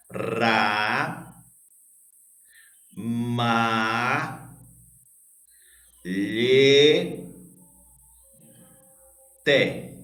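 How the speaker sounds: "Ra, ma, lhe, te" with each syllable drawn out and a long pause between. Shown as a demonstration. Teacher splitting a word for pupils to count, syllable by syllable. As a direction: separate "Ra, ma, lhe, te" very slowly, holding each vowel. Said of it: "vamos separar aqui a palavra ramalhete".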